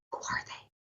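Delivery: soft breathless whisper. Acted. Child whispering to his father in the bus. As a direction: hush it like someone hiding. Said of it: "They start whispering".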